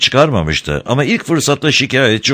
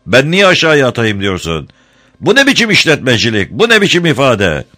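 angry interrogation; 6 semitones higher, 3 dB louder than usual. Acted. Harun angrily berates Ramazan.

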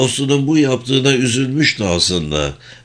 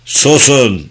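loud, raised order to be silent; roared command; 4 semitones higher, 5 dB louder than usual. A narrator's reading. Pound losing patience with the bickering family, roared command. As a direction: roar it out to silence them. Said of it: "diye gürledi".

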